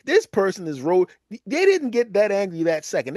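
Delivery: usual speaking voice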